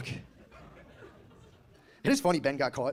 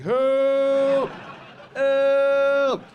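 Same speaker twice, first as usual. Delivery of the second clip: long held high shout; mock cry for help; 10 semitones higher, 9 dB louder than usual. Stranded man calling from the woods, hammed-up long shout. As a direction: yell it out like you're stranded alone.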